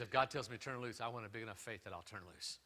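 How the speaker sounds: ordinary talk, nothing marked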